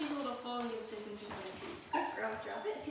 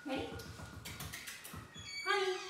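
excited call; 8 semitones higher than usual. Real, not acted. Trainer calling puppy to fetch, excited. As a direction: call her with eager energy. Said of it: "keep your voice excited".

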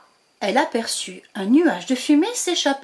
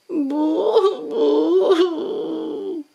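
higher mock sobbing boo-hoo; lamenting; 6 semitones higher than usual. Baby dragon lamenting to the witch, mock whimper. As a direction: whimper like a little creature. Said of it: "se lamenta bébé dragon".

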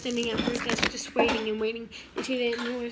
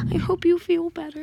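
loud, high imitation of a smitten boy; overdone retold sympathy; gushing well-wisher; 5 semitones higher, 12 dB louder than usual. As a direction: gush it out loudly, pitched up. Boy hugging her, voiced.

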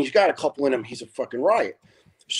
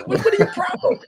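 high voice breaking into laughter; laughing mock jab; faster than usual. Gibbo ribbing Jimmy, high and laughing. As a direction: laugh through the punchline. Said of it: "He goes".

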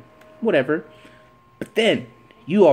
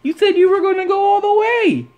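mocking taunt; 4 dB louder than usual. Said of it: "go to my friends who are Cowboy fans and say".

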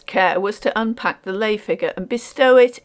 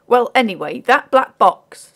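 lightly voiced porter, higher and louder; insistent porter; 4 semitones higher, 7 dB louder than usual. A narrator's reading. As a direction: press the point as a working man.

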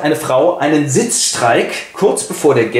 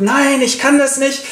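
trembling refusal; 12 semitones higher than usual. Acted. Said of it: "der zittert aber so".

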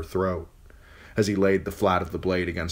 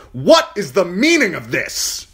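high, loud, sharp demand; whip-crack demand; 13 semitones higher, 10 dB louder than usual. Acted. Uncle bursting in on the beating, loud and sharp. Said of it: "voice cracked like a whip".

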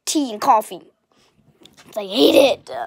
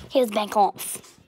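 ordinary talk

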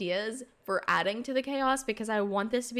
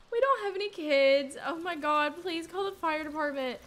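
usual speaking voice

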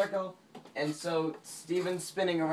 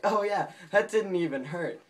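mock bravado; 5 semitones higher, 5 dB louder than usual. Acted bravado after being rescued.

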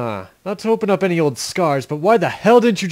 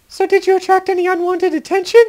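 high-pitched woman's voice; high female voice; 14 semitones higher than usual. Acted. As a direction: play her high and light. Elena voiced by same speaker.

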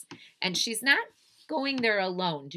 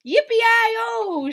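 high, loud drawn-out whoop; cowboy shout; 13 dB louder than usual. A narrator's reading. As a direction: holler like a triumphant cowboy. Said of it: "shouted the little man".

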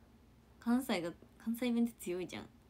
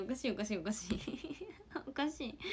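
laughing; 3 semitones higher, 7 dB quieter than usual. Real, not acted. Streamer reacts to a fan's devoted comment, laughing. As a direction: protest through real laughter.

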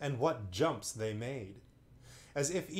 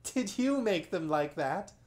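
put-on girl's voice, higher and louder; voiced girl; 7 semitones higher, 5 dB louder than usual. Lucy asking the magician about the monopods, eager. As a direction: ask it eager and amused.

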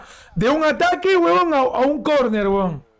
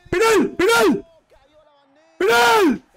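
high yelled penalty claim; penalty appeal shout; 8 semitones higher than usual. Real, not acted. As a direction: scream it at the referee. Fan demands a penalty.

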